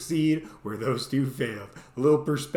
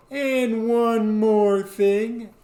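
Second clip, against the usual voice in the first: much louder and higher, drawn out slowly; pompous slow proclamation; 7 semitones higher, 6 dB louder, much slower than usual. Retold or imitated. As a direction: announce it like a self-important hero. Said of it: "and I go".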